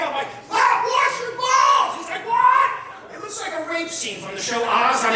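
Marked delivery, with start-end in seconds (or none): 0.5-2.0 slow, high full yell at a deaf father; drawn-out, high-pitched holler. Retold or imitated. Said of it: "I'm yelling at him". 2.3-2.7 high, loud hard-of-hearing "what"; shouted question. Retold or imitated. Hard-of-hearing father yells back.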